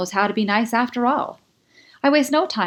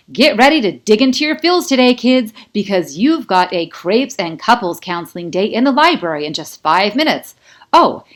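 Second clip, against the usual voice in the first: loud, high announcer voice, hammed up; a DJ booming over the speakers; loud radio host; 3 semitones higher, 9 dB louder than usual. A narrator's reading. Colt greets the students over the bell speakers with a loud, high DJ voice.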